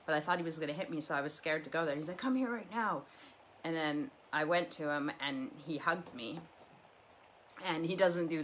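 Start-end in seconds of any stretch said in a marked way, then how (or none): 2.2-3.0 higher, firmer father's command; retold dad ordering her over; insistent dad. Dad insisting during fight.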